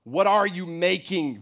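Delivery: shrill scream. A narrator's reading. Boy demanding an answer of mother, screaming. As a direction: raise it into a piercing demand. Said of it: "the boy's shrill scream came".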